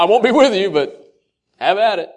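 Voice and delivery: high, loud, breezy brush-off; mock dismissive send-off. Preacher mocking a careless goodbye, breezy and loud. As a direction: toss it off like a careless dad. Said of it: "I'd rather Him say".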